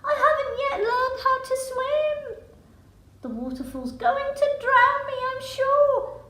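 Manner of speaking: put-on high, loud frantic young voice; a drowning cheetah's high, loud panic; panicked cheetah. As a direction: wail it as the waterfall gets closer. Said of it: "cried the cheetah in panic".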